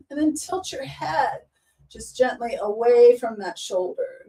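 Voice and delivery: calm, even yoga instructor voice; guided yoga cues. Acted. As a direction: talk them through each stretch gently. Leads a chair yoga practice.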